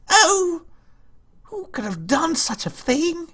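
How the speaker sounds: very high, fluttery feigned shock; mock dismay. Streamer wondering who attacked Vanuatu, hammed-up dismay. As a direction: play the innocent, scandalized way up high.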